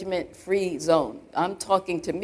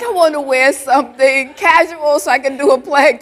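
laughing retelling; 5 semitones higher, 9 dB louder than usual. Retold or imitated. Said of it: "I was like".

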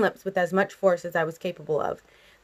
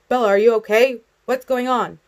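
alarmed, raised questioning; frightened; 4 semitones higher, 6 dB louder than usual. A narrator's reading. Father pounding on daughter's door, frightened. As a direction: call out with worried urgency, higher. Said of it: "His voice was frightened".

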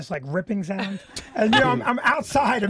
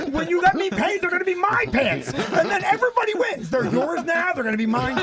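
high, laughing exasperation; higher and laughing, fed-up explaining; exasperated; 9 semitones higher than usual. Retold or imitated. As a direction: spell it out like it's obvious.

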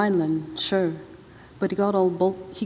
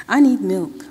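pushes the word need out louder, a sick man's demand; needy, louder complaint; insistent plea; 7 dB louder than usual. A narrator's reading. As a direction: press hard for the milk. Sick Hero demands milk.

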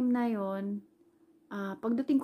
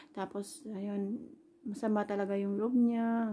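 ordinary delivery

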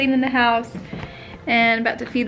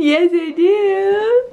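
put-on baby talk; 6 semitones higher, much slower than usual.

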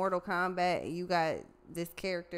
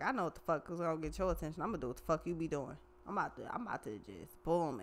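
fast scheming mutter; 4 dB quieter, faster than usual. Retold or imitated. Retold scheming quote, fast.

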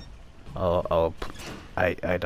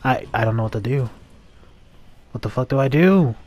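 panicked pleading; 4 semitones higher, 9 dB louder than usual. Real, not acted. Dying in game, panicking.

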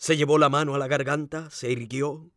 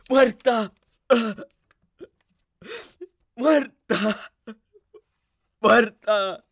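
high, loud stunned grief; anguished disbelief, word by word; dazed repeated cry; 8 semitones higher, 5 dB louder, much slower than usual. A narrator's reading. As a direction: cry it out over and over, uncomprehending. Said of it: "Lo repetía mecánicamente".

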